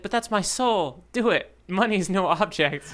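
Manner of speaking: high, slow mock plea for the guitar; mock sentimental plea. Teasing friend imitates guitarist begging repair shop, hammed-up plea. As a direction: ham up begging to save your soul's guitar. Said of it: "And you said".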